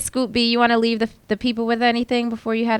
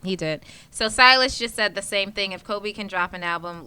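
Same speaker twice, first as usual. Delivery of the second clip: usual speaking voice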